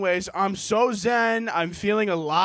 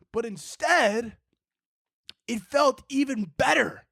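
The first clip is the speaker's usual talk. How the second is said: usual speaking voice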